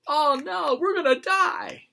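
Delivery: very high, loud squeaky panic; cartoonish panic at the bombing; mock terrified Brits. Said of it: "You hear the difference?".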